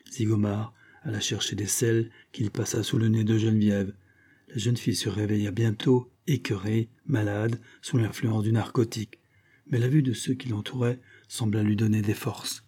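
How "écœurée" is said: usual speaking voice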